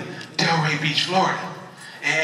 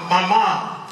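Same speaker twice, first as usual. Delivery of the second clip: usual speaking voice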